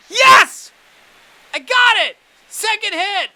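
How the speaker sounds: very high, very loud victory scream; ecstatic yell. Real, not acted. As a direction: scream with joy at the win. Finally gets the hit.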